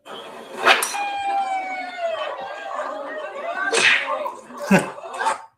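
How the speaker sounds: long high burst of laughter; big laugh. Real, not acted. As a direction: crack up at the video. Laughing at Trump's video.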